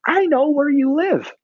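put-on high, little-kid voice; first-grade boy. Foster kid to the pastor after service, high child voice. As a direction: pitch it way up like a small child. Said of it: "the youngest, he's, like, in first grade".

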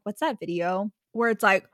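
ordinary delivery

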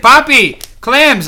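loud high call across the kitchen; calling out an order. Retold or imitated. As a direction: shout the order to Poppy.

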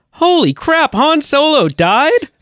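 very high, drawn-out fake shock; hammed-up high-pitched astonishment; mock shocked spoiler. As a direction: squeal the spoiler in pretend disbelief. Imagined spoiler to waiting crowd.

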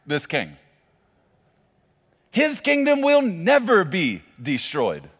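loud, slow, emphatic preaching; preaching at full force. Acted. Preacher driving home the eternal kingdom, loud and slow. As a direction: hammer each phrase home to the crowd.

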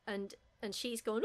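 usual speaking voice

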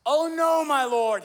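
loud, high anguished cry. Acted. The woodcutter cries out to his master in alarm. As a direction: cry out in dismay at the loss. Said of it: "he cried out".

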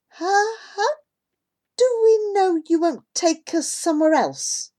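high, hesitant worried voice; nervous question. A narrator's reading. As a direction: stammer it out, uneasy.

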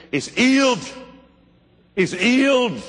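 imitated clipped English accent, h dropped, pitched high. Wigglesworth answers the ushers over the dead man, clipped English accent. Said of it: "in that clipped English accent".

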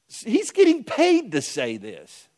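high, loud, slow outrage; real slow indignant outburst, pitched high; real indignation. Real, not acted. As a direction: spit out each word in disbelief. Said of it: "it made me so angry".

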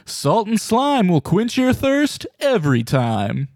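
sportscaster announcer. Acted. Mock sportscaster intro.

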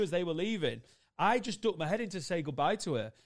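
ordinary delivery

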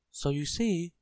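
high, small character voice; tiny Mister Small. A narrator's reading. Tiny man wondering how to reach the bell, high voice. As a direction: pitch up high for the little man.

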